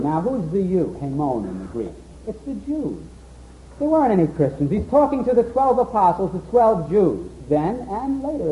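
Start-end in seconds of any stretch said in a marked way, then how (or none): none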